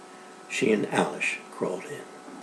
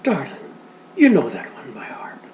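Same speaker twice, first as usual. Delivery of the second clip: gentle; 6 semitones higher than usual. Acted. Father answers child softly.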